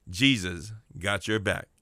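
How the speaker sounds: slow, each word spaced out for the far listeners; slow, deliberate preacher's reassurance; said real slow. Shown as a demonstration. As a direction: stretch it out so the back row catches every word. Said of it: "I'll say that real slow again".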